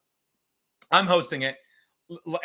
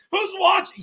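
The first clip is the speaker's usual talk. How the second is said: yelling; 12 semitones higher, 4 dB louder than usual. Retold or imitated. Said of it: "I'm yelling at him".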